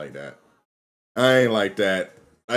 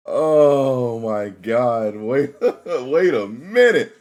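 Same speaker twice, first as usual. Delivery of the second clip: slow, higher, louder words broken by laughter; laughing disbelief; 5 semitones higher, 8 dB louder, much slower than usual. Real, not acted. Streamer reacting to a surprise in the game, laughing in disbelief.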